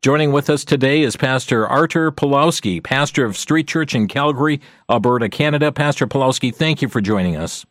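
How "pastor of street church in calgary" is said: usual speaking voice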